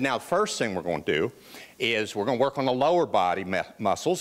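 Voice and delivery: low-pitched imitation of a Southern trainer; deep Southern trainer giving instructions; Southern accent. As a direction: play the coach with a Southern accent. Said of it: "he has this wonderful Southern accent".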